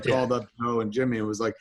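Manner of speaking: usual speaking voice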